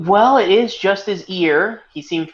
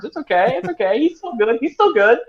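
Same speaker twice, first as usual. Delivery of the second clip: high-pitched, mock-reassuring spin; hurriedly smooth it over in a raised voice; campaign advisor; 8 semitones higher, 4 dB louder than usual. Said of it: "being his campaign advisor and being like".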